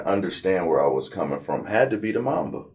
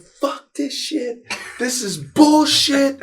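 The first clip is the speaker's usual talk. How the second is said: much higher, slow angry rant; teen rant at the reporter, slow and much higher; retold furious outburst; slower than usual. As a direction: blow up at the reporter. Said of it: "I was like".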